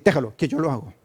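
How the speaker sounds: brusque, impatient "Déjalo"; a spouse brushing off the other, annoyed; curt irritated reply. Shown as a demonstration. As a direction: snap it off like you're fed up. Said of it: "no es lo mismo decir".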